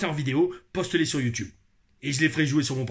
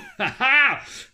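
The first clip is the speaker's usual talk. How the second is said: high, loud laugh; 8 semitones higher, 5 dB louder than usual. Real, not acted. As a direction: burst out laughing after the bit. Laughs after the message. (éclat de rire aigu)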